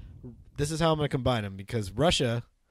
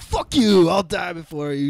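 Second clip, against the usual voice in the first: loud, high snarl of refusal; defiant henchman; 4 semitones higher, 5 dB louder, slower than usual. Acted. Delivery boy refusing to talk to the villain, loud and defiant. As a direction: spit the defiance out like a cornered thug. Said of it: "and he's like".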